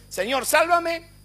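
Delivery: high, slow, pleading cry of "sálvame"; hammed-up begging prayer; mock desperate plea. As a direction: parody the believer begging for rescue. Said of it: "Y nosotros siempre".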